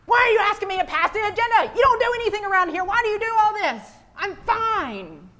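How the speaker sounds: high, loud, fast snap; snapping coworker. Retold or imitated. Stressed coworker snaps in the staff meeting. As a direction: lash out over a small request. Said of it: "and they say".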